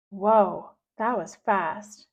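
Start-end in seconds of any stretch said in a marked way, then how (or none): none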